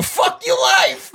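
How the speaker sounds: drawn-out high yell; a slow, high, hammed-up scream; mock shout. Shouting in a bit.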